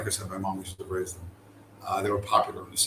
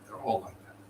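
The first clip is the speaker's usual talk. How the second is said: whispered aside; 9 dB quieter than usual. Shown as a demonstration. Teller muttering about scammer cats, soft whispered repeat. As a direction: whisper the repeat much softer.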